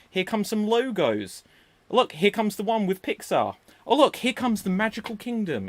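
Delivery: normal talking voice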